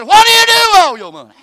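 loud, high, exasperated shout; angry husband. Acted. Husband yelling at wife asking for extra money, angry. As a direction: bark it at her like she's wasting it all.

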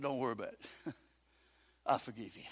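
soft and low, a tender pardon; gentle forgiveness. Retold or imitated. Joseph forgiving his brothers, soft and tender. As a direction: let it fall quiet and kind.

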